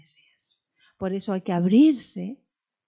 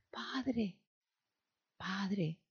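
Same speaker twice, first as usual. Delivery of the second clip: hushed, soft call to the Father; softly voiced intercession; soft pleading; 11 dB quieter than usual. Jesus interceding softly for the disciples.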